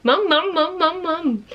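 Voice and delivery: put-on high, babbling "mum, mum" repeated to the baby; baby babble. Mum repeating the baby's babble back to him. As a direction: coax the word out like a proud parent copying baby. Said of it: "Haven't you?".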